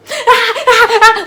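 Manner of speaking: loud, high, sustained mock wail; hammed-up panicked crying shrieks. Friends hearing of the break-in, mock panicked wail. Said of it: "We on the phone crying like".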